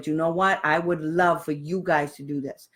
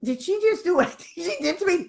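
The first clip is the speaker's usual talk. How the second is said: a lightly voiced baffled official, pitched higher; stunned officials; 10 semitones higher than usual. Mocking school staff caught off guard, lightly voiced disbelief. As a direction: play the person who just got checked, bewildered. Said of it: "they're going like this".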